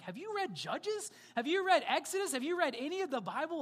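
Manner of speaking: high, rising, disbelieving questions; incredulous challenge. Real, not acted. Challenging the congregation.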